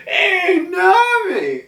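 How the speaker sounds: very high cartoon whimper; mock-sobbing greeting, squeaked out; tearful calling. Zeus spots Nami and calls her, tearful.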